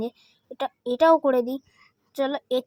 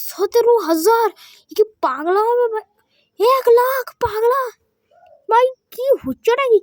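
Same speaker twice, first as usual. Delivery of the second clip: much higher and louder, disbelieving; amazed; 10 semitones higher, 6 dB louder than usual. Real, not acted. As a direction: shout in astonishment at the numbers. Amazed at huge game money. (অবাক হয়ে উঁচু গলায় চিৎকার)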